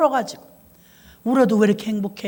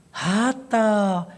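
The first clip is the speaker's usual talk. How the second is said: drawn-out exclamation; much slower than usual. Real, not acted. Exasperated dialect exclamation.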